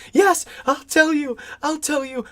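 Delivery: high, hammed-up frantic cry; high-pitched mock panic; frantic giving in. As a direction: imitate a cornered man blurting agreement. Renfield gives in, acted out.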